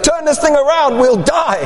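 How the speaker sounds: hammed-up fear of drowning; retold panic. Now panicked himself in storm.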